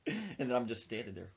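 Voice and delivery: ordinary talk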